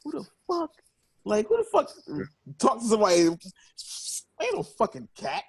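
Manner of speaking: high-pitched mock outrage; retold indignant disbelief. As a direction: replay a baffled, offended reaction. Acted indignant reaction to being hissed at.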